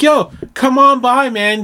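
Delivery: high, loud hammed-up dude voice; friendly stranger invite. Stranger inviting her to his pig roast, loud high dude voice. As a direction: pitch up into an eager bro inviting you over. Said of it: "and he's like".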